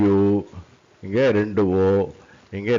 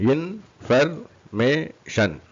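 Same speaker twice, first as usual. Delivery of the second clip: very slow, higher IN - FER - MAY - SHUN; four separate raised beats on information; syllable by syllable; 4 semitones higher, much slower than usual. Shown as a demonstration. Teacher demonstrates four syllables, syllable by syllable.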